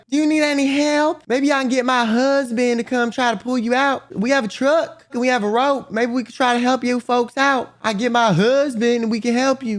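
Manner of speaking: put-on high, eager old-lady voice, pitched well up; imitating an old lady offering her husband's truck; helpful old neighbour. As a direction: offer help like a kindly country neighbour. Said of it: "She's like".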